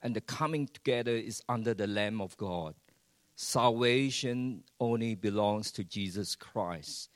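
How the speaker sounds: ordinary talk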